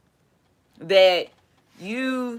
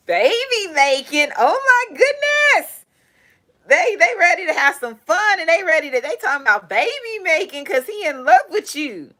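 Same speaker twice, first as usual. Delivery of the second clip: excited; 9 semitones higher, 4 dB louder than usual. Real, not acted. Reading a romantic tarot card.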